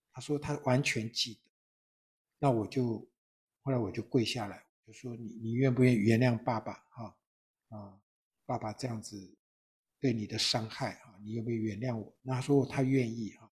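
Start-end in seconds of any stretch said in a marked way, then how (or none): 5.2-12.2 hushed, much softer plea to his daughter; softly pleading. Retold or imitated. Father kneeling, asking his daughter's forgiveness, hushed and tender. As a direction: ask forgiveness gently, on your knees.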